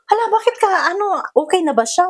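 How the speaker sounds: high startled worried question; alarmed. Retold or imitated. To the young mother returning with the sick child, alarmed and high.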